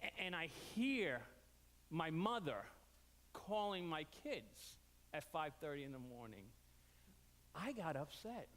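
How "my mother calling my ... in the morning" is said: usual speaking voice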